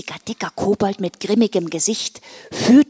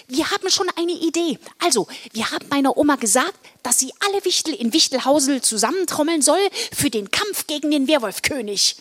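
lightly raised, hurried character voice; eager young elf; 7 semitones higher than usual. Acted. Elf tells Santa about grandma's plan, eager. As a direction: explain the plan keenly.

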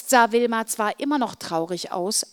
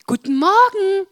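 cheerful; 11 semitones higher, 9 dB louder than usual. Acted. Cheerful greeting after bad mood.

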